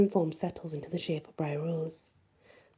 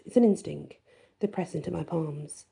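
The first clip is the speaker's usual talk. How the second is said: plain reading voice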